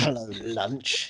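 slow, quiet hint of a character voice; drawn out softly like a sly animal; lightly voiced Dolittle quote, slow and soft; mock film line. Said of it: "he says".

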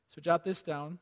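ordinary delivery

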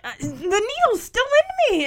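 high-pitched imitated whine of pain; mock complaining. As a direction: play someone griping about a pinprick. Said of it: "so he complains".

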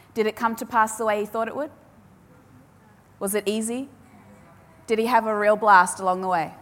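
normal talking voice

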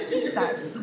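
high whispered sing-song "Jesus."; mimicking the group answer. To the congregation, mocking the life group's stock answer, high and whispered. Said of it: "in life group they always go".

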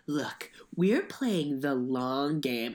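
slow, whispered smug politician; mock whisper. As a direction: whisper it smugly, slowly. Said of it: "They're very like".

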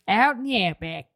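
lightly imitating the Australian accent; half-hearted Australian accent; Australian echo. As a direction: say it back in a mock Aussie twang. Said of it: "as the expert on Australian accents".

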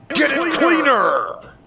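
drawn-out, high, loud pitchman bellow; hammed-up commercial catchphrase yell; tremendous booming yell. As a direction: roar it slowly like a sonic attack. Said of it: "this tremendous voice erupts from the area".

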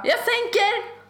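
mock upbeat promise to turn it down; eager bro voice. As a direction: chirp it out like an eager neighbour. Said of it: "Han bara".

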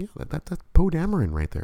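high, bright insistence; husband replaying showing his wife the picture; mock excited. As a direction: point it out like it's obvious. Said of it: "I'm like".

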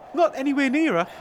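ordinary delivery

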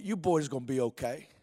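low, soft reassurance; Bob Jones. Acted. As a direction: answer the worry quietly and low. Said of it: "I've heard Bob Jones's voice in my ear saying".

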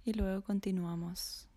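ordinary talk

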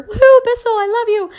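high-pitched mock fan squeal; gushing, sing-out praise in jest; playful cheer. Playful cheer to a cleaning product.

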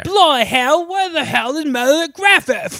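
cheeky English rock-star accent, played for laughs. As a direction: bark it like a hammed-up English rocker. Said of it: "He's literally doing, like, [name]".

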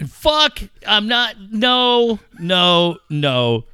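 loud, high frustrated noes; frustrated protest. Retold or imitated. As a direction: protest in exasperation. Frustrated outburst.

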